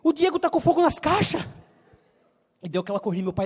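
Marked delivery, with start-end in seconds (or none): 0.0-1.4 high, loud shout about the fire; mother shouting the alarm to the father. Retold or imitated. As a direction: raise the alarm across the market. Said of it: "Ele olhou assim".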